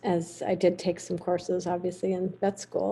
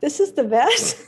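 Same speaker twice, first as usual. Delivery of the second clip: higher, incredulous "What? This is the vet?"; mock disbelief; 7 semitones higher, 5 dB louder, much slower than usual. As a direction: play the stunned cowboys doubting her. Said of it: "everybody there were just like".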